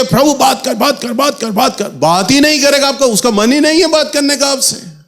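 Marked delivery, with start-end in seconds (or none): 0.0-1.9 a hint of whiny, insistent begging; mock pleading. Preacher mocks believers who pester God to speak, lightly whiny. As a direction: nag God half-mockingly, over and over.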